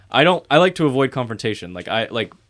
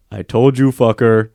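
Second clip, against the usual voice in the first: loud imitation of a coworker's catchphrase; mimicked coworker's catchphrase; 8 dB louder than usual. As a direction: belt it out mockingly as his line, without raising pitch much. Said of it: "this thing he says just sticks in my head".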